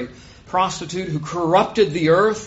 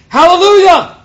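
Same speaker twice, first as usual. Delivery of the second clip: very high, thunderous shout; full-force cry of praise; 17 dB louder than usual. A narrator's reading. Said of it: "of thunder crying out".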